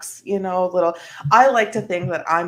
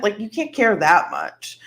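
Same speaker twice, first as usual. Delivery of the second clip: ordinary talk, nothing marked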